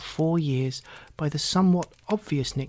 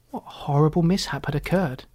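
ordinary delivery

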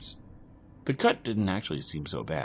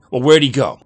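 raised voices; 7 semitones higher, 9 dB louder than usual. Acted. Argument at the bar.

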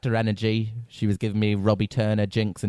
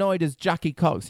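ordinary delivery

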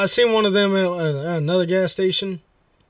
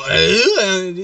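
a short disgusted grunt, louder, imitating a comedian; mock grunt; 6 dB louder than usual. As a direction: grunt it like you're mocking him. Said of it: "He wouldn't be going".

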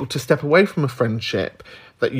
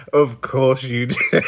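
slow echo breaking into laughter; laughing imitation; slower than usual. Host echoing the caller's read to filth, laughing. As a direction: draw it out, then crack up.

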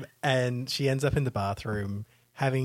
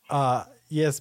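ordinary talk

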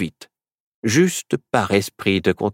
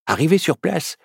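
usual speaking voice

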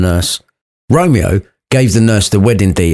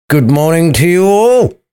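mock-cheery, higher hello; sarcastic greeting; 9 semitones higher than usual. Romeo greets his teasing mates, sarcastic. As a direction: greet them with dry sarcasm. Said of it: "replied Romeo sarcastically".

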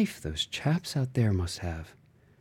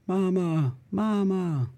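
put-on higher, frightened little child's voice; child's call; 11 semitones higher, slower than usual. Hiding child calling for mama, higher voice.

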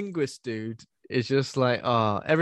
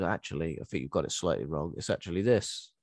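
low, soft, fast smug correction; know-it-all linguist; 7 semitones lower, 6 dB quieter than usual. Retold or imitated. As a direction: correct them quietly like a smug expert. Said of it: "And he goes".

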